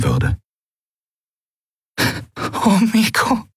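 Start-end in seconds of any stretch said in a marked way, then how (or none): 2.6-3.5 high, crying, hushed; tearful whisper. Acted. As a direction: whisper through tears. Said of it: "flüsterte sie".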